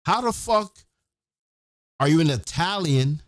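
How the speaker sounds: ordinary delivery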